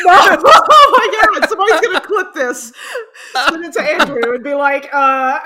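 loud, high, laughing mortified protest; real belly laugh breaking up the words; helpless laughter. Real, not acted. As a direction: protest loudly while cracking up. Cracks up after being called out.

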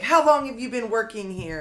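high, loud, slowed outraged demands; raging old woman. Retold or imitated. Retelling the elderly customer raging at the cashier, mock fury. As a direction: hammer each line like an entitled customer losing it. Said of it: "And she was like".

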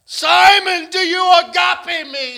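high, loud dramatic plea; Jesus' voice. Acted. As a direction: cry out the question like Jesus calling. Preacher voicing Jesus' question.

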